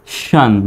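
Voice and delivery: শান as one blended "shyan" sound; pronunciation model. Shown as a demonstration. Teacher showing how the word ending sounds, modelled pronunciation. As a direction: draw out the opening sh of শান into "shyan". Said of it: "এরকম".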